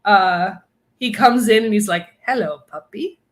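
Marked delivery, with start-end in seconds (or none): 2.2-3.2 put-on light Russian accent, softer; a hint of a Russian spy greeting. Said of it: "in a Russian accent".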